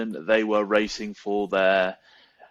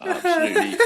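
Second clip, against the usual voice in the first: laughing; 3 dB louder, much faster than usual. Real, not acted. Breaks into a laugh mid-sentence.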